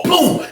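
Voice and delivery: high, sudden "boom"; impact boom. Retold or imitated. Storyteller mimicking the man hitting the wall, explosive. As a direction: voice the crash as one burst.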